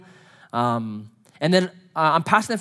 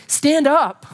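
much higher and louder, a rallying cry; rousing call; 9 semitones higher, 5 dB louder than usual. Acted. Ring it out like a summons. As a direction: pastor calling the hurt in the room to rise, loud and high. Pastor urging survivors to rise.

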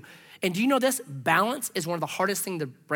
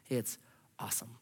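awed whisper; 7 semitones lower, 12 dB quieter than usual. Acted. Awed aside about Peter.

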